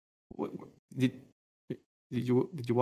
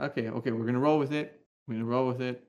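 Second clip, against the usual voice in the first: ordinary delivery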